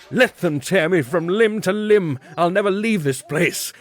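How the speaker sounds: high, loud, frantic defiance; defiant cry. A narrator's reading. Guilty man refusing to move from the dogs, crying out. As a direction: scream it out clinging to the chair. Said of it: "I cried".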